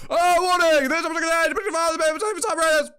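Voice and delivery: very high, loud, rapid alarm; shouted warning. Pretend. Streamer bellowing a content warning before the chapter, frantic high shouting. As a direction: blare out a frantic mock warning.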